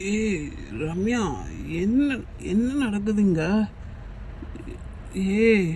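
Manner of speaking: very slow, woozy drawl; soft, dragging, half-conscious confusion; drugged fading. Acted. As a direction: sink into a drugged stupor as you ask. Drugged man fading, calls to woman.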